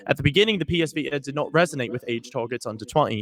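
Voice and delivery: mock British news delivery; British accent. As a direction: read the story in a put-on British accent. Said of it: "I read it in a British accent".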